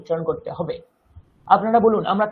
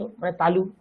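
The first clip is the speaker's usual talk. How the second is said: ordinary talk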